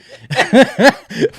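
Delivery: big, high burst of laughter; loud high laugh. Real, not acted. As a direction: crack up loudly. Cracking up at a surprise line.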